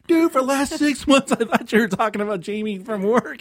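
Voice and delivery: loud, high-pitched retold disbelief; exasperated confession. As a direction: blurt out the mix-up, acted and worked up.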